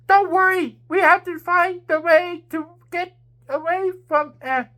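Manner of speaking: high, loud reassuring call; shout. A narrator's reading. Queen shouting to her friends while fleeing the dragon. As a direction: shout over the danger to calm them. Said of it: "Queen Diamond shouted".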